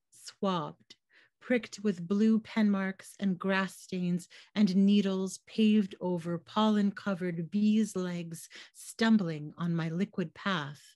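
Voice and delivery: usual speaking voice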